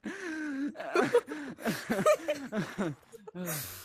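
helpless giggling fit; tickled laughter. Real, not acted. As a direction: laugh like you're being tickled. Being tickled in game.

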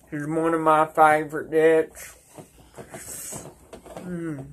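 talking around tobacco dip; dip in lip. Real, not acted. Seen 1: Lower lip looks pushed out; finger at lip while tasting dip.

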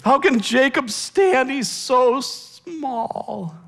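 high, loud cry, then slowing to a plea; pleading prophet. Acted. Amos pleading with God to spare Israel. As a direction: beg God like Amos interceding. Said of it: "And he pleads".

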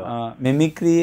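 usual speaking voice

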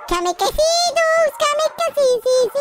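KAMIKAZE in a high, drawn-out squeal; silly squeal. Pretend. Player cheers on Kamikaze dogs, high silly squealing.

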